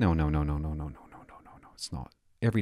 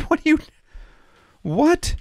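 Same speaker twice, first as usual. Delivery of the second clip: high squeaky what, cut off by a laugh; shocked disbelief; 6 dB louder than usual. Real, not acted. Reacting to the newspaper headline, high shocked disbelief. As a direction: react in pure disbelief, much higher and louder.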